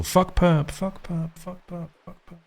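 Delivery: high-pitched echo dying away; fading echo. Acted. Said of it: "it just echoes through the walls".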